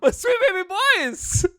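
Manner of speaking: very high, slow, gushing cry; hammed-up parent calling the babies home; mock doting parent wail. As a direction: stretch it out in a high squeal. Calls blood cells home as parent.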